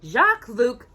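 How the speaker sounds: ordinary delivery, nothing marked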